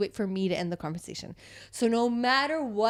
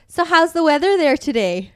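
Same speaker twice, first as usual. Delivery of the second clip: mock chatty; 6 semitones higher, 10 dB louder than usual. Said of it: "started being like".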